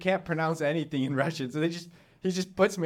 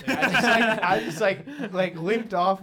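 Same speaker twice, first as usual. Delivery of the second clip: higher and louder, laughing through the words; 8 semitones higher, 6 dB louder than usual. Real, not acted. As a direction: crack up while telling it. Laughing at the memory.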